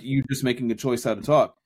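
ordinary delivery

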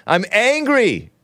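high, slow sarcastic "I'm angry"; mocking all caps. Host reads the tweet aloud, slow sarcastic mockery. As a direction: stretch out "I'm angry" with a high, scornful mock. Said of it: "she puts in all caps".

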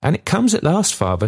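high, gently wistful question; touch of sadness. A narrator's reading. Daughter asks father about promised work, touch of sadness. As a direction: ask father with understated sadness. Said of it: "with a touch of sadness in her pleasant voice".

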